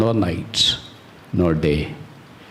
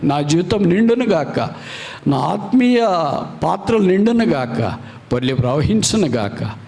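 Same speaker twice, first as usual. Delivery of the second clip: rising prayer; 5 semitones higher than usual. Real, not acted. Preacher praying over the congregation, rising in fervour. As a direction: lift each line higher.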